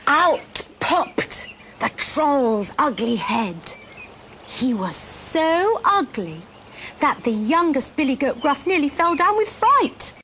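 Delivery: slow, suspenseful picture-book telling; dramatic children's storytelling. A narrator's reading. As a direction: stretch it out and build to the fright.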